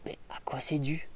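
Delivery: whispered musing question. Real, not acted. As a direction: ask it under your breath. Wondering aloud, whispered.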